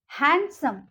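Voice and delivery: ordinary talk, nothing marked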